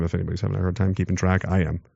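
quick, hushed aside to the audience; quiet aside. Real, not acted. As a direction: drop the voice and toss it off fast.

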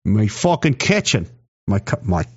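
badly faked Australian accent; attempted Australian accent. Shown as a demonstration. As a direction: try an Australian accent and miss. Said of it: "I can't do the Australian accent".

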